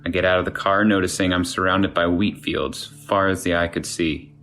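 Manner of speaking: ordinary delivery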